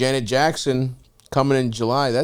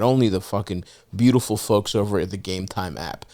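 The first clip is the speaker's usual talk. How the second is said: ordinary talk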